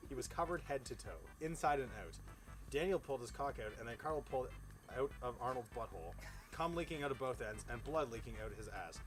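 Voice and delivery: ordinary talk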